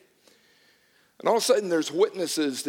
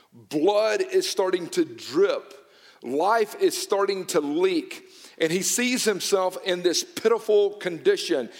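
usual speaking voice

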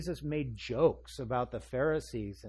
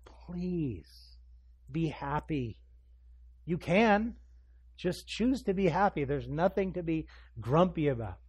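parent's coaxing plea, rising higher; pleading; 3 semitones higher, 3 dB louder, slower than usual. Retold or imitated. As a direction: coax a sulking child, imitating a pleading parent. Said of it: "I find myself pleading with her".

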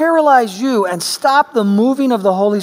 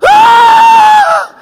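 a shrill startled shriek, much higher and louder; terrified scream; 12 dB louder than usual. Retold or imitated. Mother grabbed under the table, screaming in fright. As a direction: scream as if something grabbed your foot. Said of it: "She jumped up and went".

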